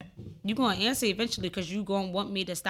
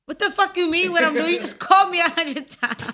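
loud annoyed yell; angry yell; 9 semitones higher, 9 dB louder than usual. Acted. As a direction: snap back, loud and irritated. Acted angry answer.

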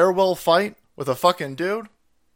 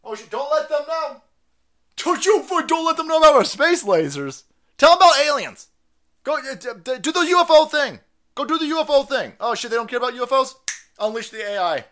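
high, loud panicked yelling; panicked schemers; 8 semitones higher, 4 dB louder than usual. Retold or imitated. Mocking panicked elites hiding space lasers, high frantic yells. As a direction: panic like caught villains. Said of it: "are screaming".

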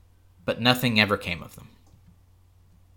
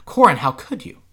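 excited lady; 6 semitones higher, 6 dB louder than usual. A narrator's reading. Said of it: "threw her arms around him, kissed him".

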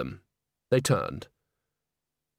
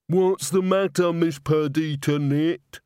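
higher, slow, drawn-out character voice; simple handyman; 5 semitones higher, slower than usual. A narrator's reading. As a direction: call over slowly, like a simple soul. Walter calls out from far off.

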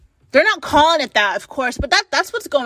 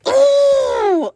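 a high, loud strangled ooh of rage; frustrated squeal; 12 semitones higher, 4 dB louder than usual. Real, not acted. Vlogger boiling over at the news, high frustrated squeal. Ranting, about to implode.

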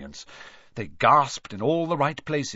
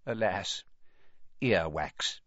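choked disappointment; 3 semitones lower, 5 dB quieter, slower than usual. A narrator's reading. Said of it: "he choked and said".

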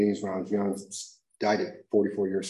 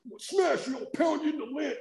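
much higher, hammed-up challenger voice; mock tough guy. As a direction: taunt like a cartoon brawler. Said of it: "I'm like".

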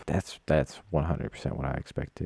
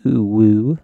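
high, loud cooing at a pet; high-pitched pet voice; 6 semitones higher, 12 dB louder than usual. Shown as a demonstration. Showing the high-pitched voice people use on animals, loud coo. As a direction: coo like talking to a puppy. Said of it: "you know, the high pitch".

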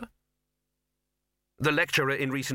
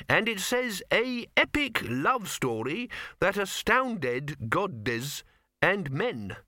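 reading aloud; 8 semitones higher, much slower than usual. Acted.

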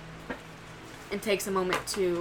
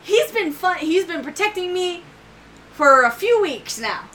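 higher and louder little voice; childlike Elsie voice; 8 semitones higher, 6 dB louder than usual. Acted. Elsie defending her protector to her brother, high eager voice. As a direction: speak up as a small eager girl.

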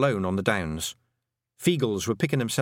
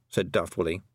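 ordinary delivery

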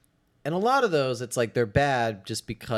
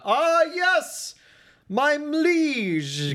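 very high, loud pompous bow; hoity-toity villain voice; 4 dB louder, much slower than usual. Retold or imitated. Host mocking Travolta's grand greeting, high pompous voice. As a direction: greet like a preening alien courtier. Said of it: "how he talks that way".

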